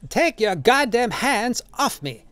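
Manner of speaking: high, drawn-out indignant character voice; outraged cry. A narrator's reading. Old man to the man pushing him, high outraged cry. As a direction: pitch it far up and stretch the words. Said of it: "His voice rang clear".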